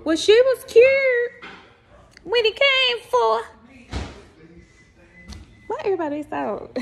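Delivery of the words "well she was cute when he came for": slow, high, drawn-out words; imagined admiring remark. Acted. Onlookers remarking when He comes for her, high and drawn-out. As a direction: stretch each phrase up high. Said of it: "I'm like, "Oh, Lord".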